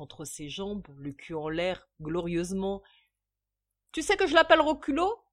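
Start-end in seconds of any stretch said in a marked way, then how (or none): 3.9-5.2 loud, high, quick question; proud drunken gushing. A narrator's reading. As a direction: boast like a tipsy proud father. Drunk father proudly boasting of baby. (fierté d'ivrogne, voix forte)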